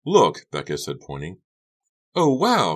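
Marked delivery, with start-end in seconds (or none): 0.0-0.4 urgent look. Acted. Pointing at trashed locker. 2.1-2.8 high, loud oh wow; exclaiming. Acted. Carrie sees the trashed locker, high loud exclaim. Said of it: "Carrie exclaimed".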